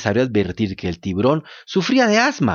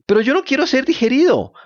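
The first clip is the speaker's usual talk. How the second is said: tearful yell; 10 semitones higher than usual. A narrator's reading. Pinocchio protesting to the tuna, crying yell. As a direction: yell it through tears. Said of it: "vociferó Pinocho, volviendo a llorar".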